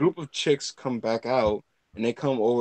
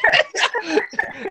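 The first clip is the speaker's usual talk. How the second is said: high burst of laughter. Real, not acted. Loud laugh.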